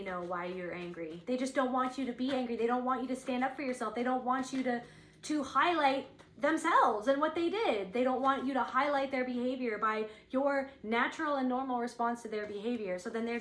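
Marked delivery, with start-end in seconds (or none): 5.2-7.9 slow, louder, higher emphasis; emphatic. Real, not acted. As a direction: lean hard on every word. Indignant emphasis on others' behavior.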